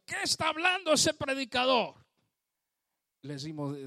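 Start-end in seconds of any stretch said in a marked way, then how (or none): 0.0-2.0 a retold angry heckle, pitched up; angry shout. Angry stranger heckling the preacher, retold yell. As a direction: snap at the preacher like an annoyed stranger. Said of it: "nos gritó diciendo".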